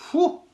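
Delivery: high whistled whew; a sharp whistling whew; whistle. Acted. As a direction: whistle in surprise.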